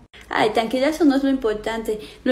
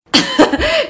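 mocking laugh.

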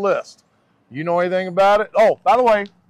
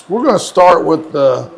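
ordinary talk, nothing marked

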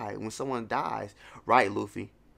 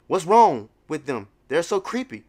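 normal talking voice

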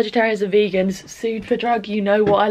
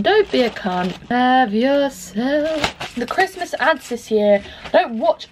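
ordinary talk, nothing marked

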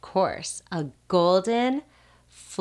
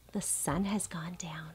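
whisper; 12 dB quieter than usual. Acted. Bedtime ending of barn book.

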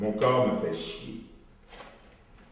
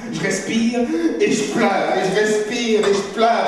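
higher and louder labour breaths; loud forced crying; 13 semitones higher, 13 dB louder, slower than usual. Pretend. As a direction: heave out the tears.

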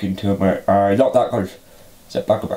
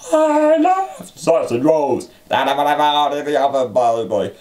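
squeaky silly voice; 4 semitones higher than usual. Shown as a demonstration. Repeating the words for the toy, in a squeaky silly voice. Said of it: "say the words".

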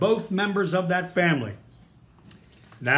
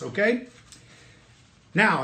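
ordinary talk, nothing marked

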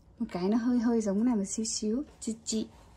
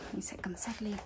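whispered aside; 9 dB quieter than usual. Real, not acted. Aside while picking up the book.

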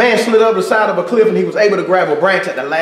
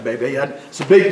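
normal talking voice

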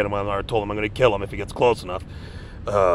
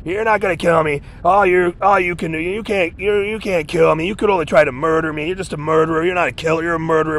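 defiant shouting; 6 semitones higher than usual. Retold or imitated. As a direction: taunt him that he can't kill you. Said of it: "I'm always saying this to these goddamn murder monsters".

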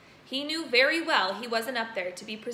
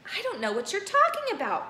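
puzzled; 10 semitones higher, 5 dB louder than usual. Acted. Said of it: "more puzzled than ever".

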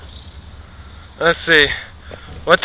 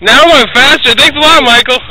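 high, loud excited yells; excited yelling; 12 semitones higher, 6 dB louder, slower than usual. Real, not acted. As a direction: yell with thrill as it speeds up. Spinning on a fast ride.

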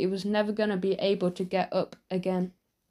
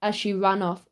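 usual speaking voice

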